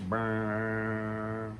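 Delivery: low sputtering motor noise; lip-buzz a weak, choked engine; imitated engine sputter.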